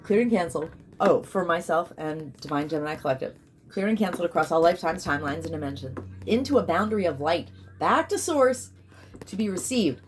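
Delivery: commanding ritual declaration; incantation decree. Real, not acted. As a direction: proclaim it like a spell.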